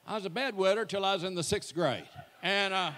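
normal talking voice

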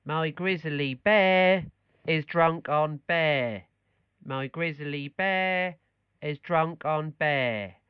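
put-on beer in drunk on bear said as bear; slow drill, Norfolk vowel, bear for beer; norfolk accent. Shown as a demonstration. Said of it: "Try saying".

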